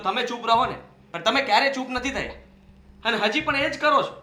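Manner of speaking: angry scolding. Acted. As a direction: rebuke your mother hard. Son scolds mother angrily.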